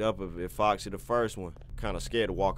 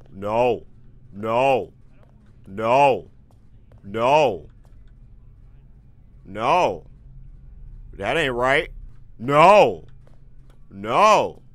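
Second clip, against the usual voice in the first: being chased; 2 semitones higher, 9 dB louder, much slower than usual. Real, not acted. Animatronics chasing him.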